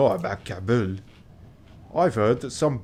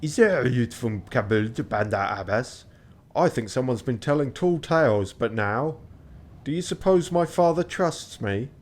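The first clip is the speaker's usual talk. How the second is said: put-on lightly French-accented young gentleman; a hint of French in a polite query; French-accented reading; 2 semitones higher than usual. Reads character dialogue in French accent.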